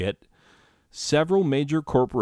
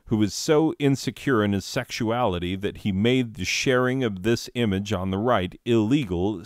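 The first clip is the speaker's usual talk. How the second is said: normal talking voice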